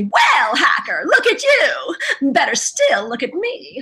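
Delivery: drawn-out, swooping character voice; Wicket catchphrase voice. Shown as a demonstration. Voice actor showing her warm-up line, animated character voice. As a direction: launch into the catchphrase, stretching the words. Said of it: "my thing would always be".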